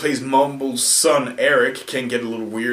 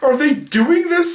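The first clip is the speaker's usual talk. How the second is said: disbelieving outburst, voice shooting much higher; what on earth, are they serious; retelling shock at the celebrity casting, pitch leaping up; incredulous; 12 semitones higher than usual. Retold or imitated. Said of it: "and I'm like".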